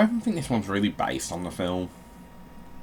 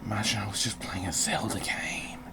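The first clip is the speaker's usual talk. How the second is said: whisper; 5 dB quieter than usual. Real, not acted. Whispered aside.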